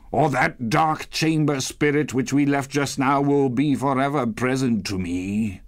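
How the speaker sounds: Scrooge's troubled appeal; Scrooge, pleading. Acted.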